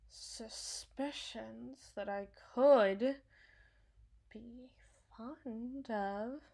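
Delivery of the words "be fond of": quiet, halting and half-whispered; hushed confession. Acted. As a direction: let it slip out softly, with long hesitations. Hesitant confession of unsettling feelings.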